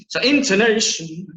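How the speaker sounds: "intonation" sung out high and loud; exaggerated intonation. Shown as a demonstration. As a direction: lift and swing the tune on "intonation". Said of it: "we can think about our intonation".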